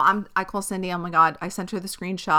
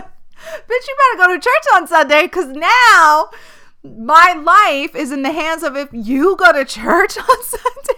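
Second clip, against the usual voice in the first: high and loud, laughing at the end; mock scolding yell; 9 semitones higher, 10 dB louder than usual. Ordering her mom to church, loud and high. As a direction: boss her mom around in a shrieking joke. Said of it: "I said".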